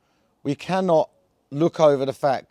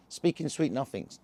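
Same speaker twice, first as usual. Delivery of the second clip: ordinary talk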